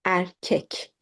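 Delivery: "Erkek" with a clear e in both syllables; correct pronunciation. Shown as a demonstration. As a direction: model "Erkek" on its own as er-kek. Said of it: "because of e".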